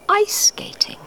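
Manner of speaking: high, hushed secret reveal; high conspiratorial whisper for the reveal. A narrator's reading. Big reveal of ducks' secret.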